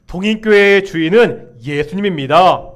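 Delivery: loud, firm proclamation; confession of faith. Real, not acted. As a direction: declare it strongly for all to repeat. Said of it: "다시 한번 고백하겠습니다". (힘차게 선포하는 신앙 고백)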